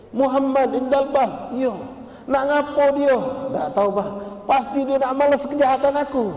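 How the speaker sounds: suara tinggi, tergesa dan cemas; panik ketakutan. Acted. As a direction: bicara seperti orang ketakutan. Ayah dan anak panik. (ayah yang sakit ketakutan mendengar Nabi datang)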